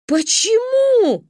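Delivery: протяжный высокий вой; от разочарования; взвыла. Acted. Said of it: "взвыла она". (громко, протяжно)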